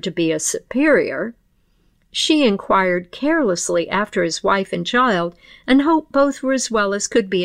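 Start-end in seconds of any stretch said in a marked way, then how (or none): none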